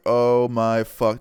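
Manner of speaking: slow, loud, drawn-out disbelief; stunned dismay. Retold or imitated. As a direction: stretch each word in dismay. Stunned reaction to police call.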